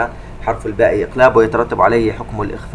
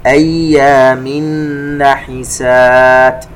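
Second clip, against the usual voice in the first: slow, melodic tajweed chant; measured Quranic recitation; 3 semitones higher, 7 dB louder, much slower than usual. Shown as a demonstration. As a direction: recite slowly with held nasal sounds. Recites verse segment.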